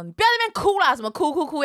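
irritated scolding. Retold or imitated. Boyfriend telling his crying girlfriend off, irritated scolding. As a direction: imitate an exasperated partner snapping.

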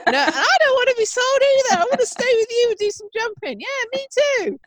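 high squeaky pleading animal voice; a pony begging not to be sold, through laughs; dog voice. Pretend. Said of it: "do your dog voice for the horses".

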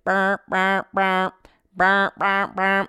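monotone buzzing ringtone noise; imitated phone ringing. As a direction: mimic a phone going off in even bursts. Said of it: "all of a sudden, my phone's ringing".

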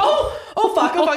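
squealing high-pitched panic; panicked high squeal. Retold or imitated. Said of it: "I was like".

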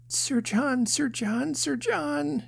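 put-on high, sing-out calling in a little girl's voice; child's excited cry. A narrator's reading. Feverish child greets Sir John, crying out excitedly. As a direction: call the name high and eagerly, held up. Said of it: "she cried".

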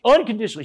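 "Unconditionally" pitched high and punched; emphatic repetition. Retold or imitated. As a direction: lift and drive home "Unconditionally". Mother's word repeated for emphasis.

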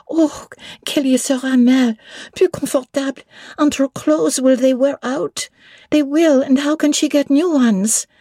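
put-on high child voice asking in French; little French girl. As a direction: ask it like an eager little girl. Adele worries.

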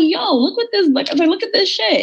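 high, wound-up retelling of the call to look; excited urging. Retold or imitated. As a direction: push him to look, all worked up. Urging ex to see screenshots.

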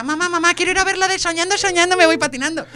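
put-on little girl begging mum. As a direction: beg mom like a small child. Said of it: "imagínate la niña pequeña".

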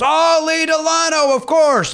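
high, slow, pleased old man, mildly imitated; imitating Mel Brooks. Mel Brooks greets the host in the dressing room, high and slow. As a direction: play the delighted old comic remembering his friend. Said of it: "And he goes".

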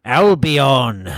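put-on a loud, drawn-out British accent on "Albion"; mock British accent. Host answers his cohost with "Albion" in a loud mock British accent. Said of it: "can't do my British accent anymore".

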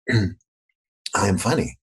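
slow, deliberate mock announcement; understated, measured stage declaration; marketer on stage. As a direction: deliver a stiff message to the audience, drawn out. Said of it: "stand up in front of everybody and they'd say".

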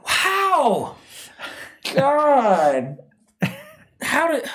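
high, squeaky amazed gasp of words; mock-disbelieving wow. Real, not acted. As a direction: sound floored by the news. Shocked show ran since 2007.

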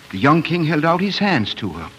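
normal talking voice

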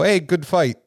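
friendly Canadian player after a fight; bad Canadian accent. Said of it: "my terrible Canadian accent".

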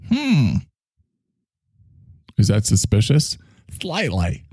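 ordinary delivery

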